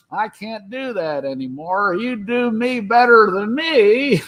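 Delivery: slow elderly actor impression; Don Knotts. Old actor conceding to his younger impressionist, slow elderly impression. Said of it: "and he's old, and he goes".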